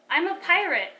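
loud, high boast; bragging. A narrator's reading. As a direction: show off like a proud kid.

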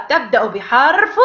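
ordinary delivery

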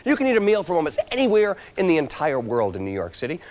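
high, upbeat game show host; booming prize announcer. Acted. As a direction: pump up the studio audience.

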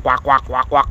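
mock honking like a goose; goose honk imitation. Vlogger imitating geese calling from above, mock honk. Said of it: "yung mga sinasabi lang".

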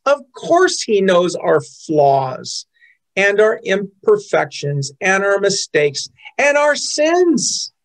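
normal talking voice

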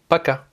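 paka said with a clear Russian accent on the final a. Shown as a demonstration. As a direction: give paka a careful Russian sound, stress on ka. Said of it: "La toute première manière".

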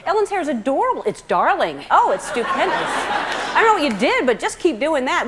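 mock gushing with swooping pitch; hammed-up adoring praise, singsong swoops; overboard gushing. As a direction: imitate people overdoing the compliments. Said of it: "And then they go overboard with it".

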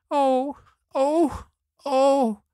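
drawn-out, very high "oh" cries; high puppet wail. Acted. Hungry puppet cries over his lost feet, high wailing. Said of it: "began to scream and cry so loudly".

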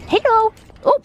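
high, loud sing-song pet voice. Real, not acted. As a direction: gush over the dog. Greeting dog in baby talk.

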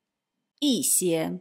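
一些 as yì xiē, 一 falling, said slowly; slow model of 一些 with a falling 一; careful yì xiē, 一 falling; 一 in fourth tone. Shown as a demonstration.

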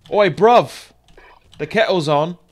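loud mock-British call; badly faked British hail; bad British accent. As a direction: shout it in a fake British accent. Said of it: "do a British accent this badly".

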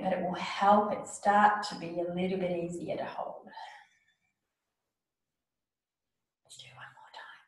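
breathy whisper, voice dropped away; whispering the breathing cue; whispered guidance. Shown as a demonstration. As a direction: hush it like a secret. Teacher guides breathing softly.

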